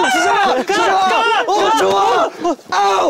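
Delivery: high, excited cheers mid-rally; urgent calling out. Real, not acted. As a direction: call to your teammate in play. Calling to teammate during a rally. (경기 중 높게 외치는 응원)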